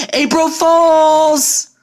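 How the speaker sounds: spring the surprise with a yell; gleeful shout. Acted. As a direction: friends leaping out at the surprise party, shouting.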